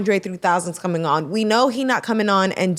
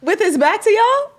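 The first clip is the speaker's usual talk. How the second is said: high incredulous question; 13 semitones higher than usual. Real, not acted. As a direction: ask in disbelief, pitched up.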